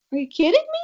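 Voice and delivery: imitating dad's disbelief, pitched high; a retold "are you serious?"; dad replaying his own amazement at the register; incredulous. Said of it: "And my dad was like".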